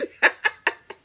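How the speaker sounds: a loud breathy laugh. Real, not acted. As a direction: crack up again. Laughing at the compliment.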